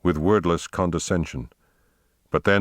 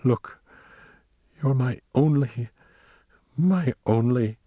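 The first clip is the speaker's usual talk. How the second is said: high, halting, tearful tenderness; choked-up love, pitched up; 6 semitones higher than usual. A narrator's reading. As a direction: break off between words, overcome. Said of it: "in a choked voice".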